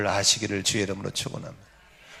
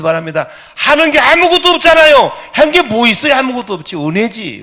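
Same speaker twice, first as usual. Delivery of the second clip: building to a high, loud cry; impassioned preaching; 7 semitones higher, 9 dB louder than usual. Real, not acted. Preacher urging congregation to believe in grace, impassioned. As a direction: raise the sermon to a peak.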